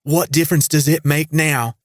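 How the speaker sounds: thick drawl, high and loud; angry drawl. A narrator's reading. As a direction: drag the vowels out in anger. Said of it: "her angry drawl as thick as molasses".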